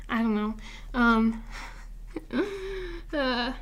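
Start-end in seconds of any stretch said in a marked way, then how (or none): 2.1-3.1 tearful shaky laugh-sigh; a wobbly breath fighting back tears. Real, not acted. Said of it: "I'm trying not to cry". 3.1-3.5 holding back tears. Real, not acted. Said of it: "I'm trying not to cry".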